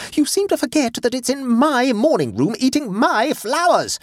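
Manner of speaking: high-pitched furious comeback; shrieking indignation; raging retort. A narrator's reading. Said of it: "came the raging retort".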